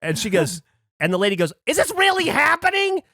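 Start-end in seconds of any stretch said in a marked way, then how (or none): 1.6-3.0 high, indignant mock outrage; angry. Lady watching the proposal, angrily incredulous. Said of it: "Like, angry".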